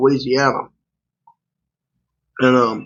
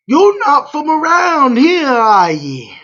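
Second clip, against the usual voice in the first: put-on Louisiana accent; 10 semitones higher, slower than usual. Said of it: "do this Louisiana accent".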